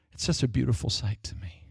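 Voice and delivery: soft whisper of wonder; awed whisper. Real, not acted. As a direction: breathe it out in awe. Awed aside on the deliverance.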